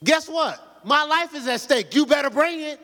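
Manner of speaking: preaching rebuke at full push; forceful rebuke. Real, not acted. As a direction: drive it home hard. Preacher repeats demand.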